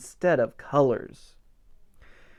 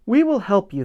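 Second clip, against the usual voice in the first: butterflies; 6 semitones higher, 5 dB louder, faster than usual. Acted. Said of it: "they said".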